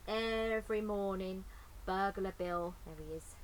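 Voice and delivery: ordinary delivery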